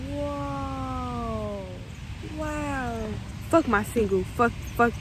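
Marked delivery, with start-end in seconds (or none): 0.1-3.1 soft, long-held amazed murmur; hushed, dreamy amazement; spaced-out wonder. Real, not acted. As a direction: draw each word out in dazed awe. Spaced-out wonder while on shrooms.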